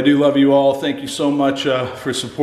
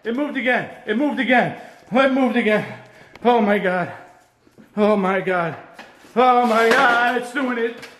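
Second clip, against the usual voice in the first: real terrified high-pitched cries; 10 semitones higher than usual. Real, not acted. Ghost hunter seeing the ball move again, screaming. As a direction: squeal it over and over in panic. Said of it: "I'm screaming like a little girl".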